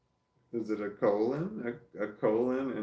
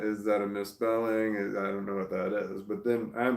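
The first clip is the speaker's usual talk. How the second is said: normal talking voice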